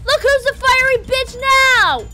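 high, loud frantic shouting; panicked yelling. Real, not acted. Player on fire yelling while fighting the bush devil. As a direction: yell in full panic.

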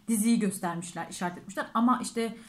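talking while taking bite; chewing food while speaking; fork in mouth. Real, not acted. Seen 4: Left speaker puts forkful in mouth at start, chews afterward.

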